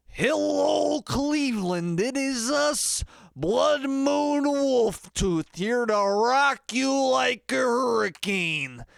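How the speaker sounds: huge, high, drawn-out stage yell; rock frontman opener. Shown as a demonstration. Singer greeting Cleveland to start a show, over-the-top rock frontman. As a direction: holler it to the arena, stretching each word. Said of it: "you might start off a show".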